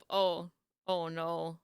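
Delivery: ordinary talk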